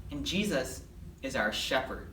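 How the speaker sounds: ordinary delivery, nothing marked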